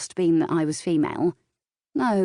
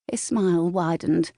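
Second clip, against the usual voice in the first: usual speaking voice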